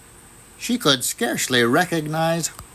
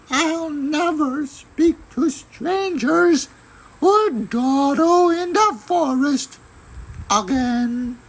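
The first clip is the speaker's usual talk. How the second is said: put-on much higher, sweet girl's voice; little girl voice. A narrator's reading. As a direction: sound like a small innocent girl. Girl character voice.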